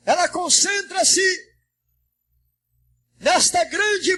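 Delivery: high, ringing pulpit oratory; fervent preaching. Real, not acted. Pastor preaching the rapture to the church, high fervent oratory. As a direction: proclaim each phrase forcefully, then pause.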